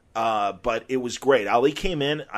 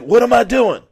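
high, loud mock astonishment; mock amazed [name]; 10 semitones higher, 9 dB louder than usual. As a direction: play dumb, loud and high. [name] teasing during levitation trick.

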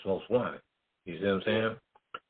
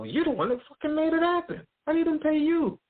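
very high, louder, altered imitation of an angry boss; outraged; 3 dB louder than usual. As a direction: swear it out in disbelief. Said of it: "Don go".

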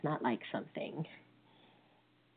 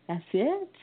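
surprised; 6 semitones higher, 4 dB louder than usual. Retold or imitated. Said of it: "I'm like".

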